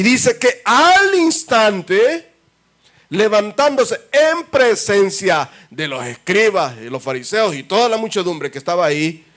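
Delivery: high, loud, slow triumphant telling; climactic preaching. Acted. Preacher telling the crowd of the healing, loud and triumphant. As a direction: build it to a joyful climax.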